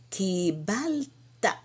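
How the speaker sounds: "Kibalta" very slow, long "ki" and "bal", separate "ta"; drawn-out syllables. Shown as a demonstration. Teacher showing how to say "you received", drawn-out syllables. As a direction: stretch each syllable of "Kibalta". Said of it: "você vai falar kibalta".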